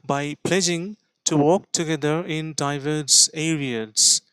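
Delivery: very slow, careful English read-aloud; slow model reading. Shown as a demonstration. Teacher reads the news line slowly for students. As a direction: read it word by word for learners. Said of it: "এই areas এর উচ্চারণটা".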